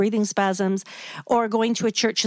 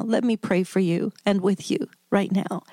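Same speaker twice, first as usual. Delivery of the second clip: usual speaking voice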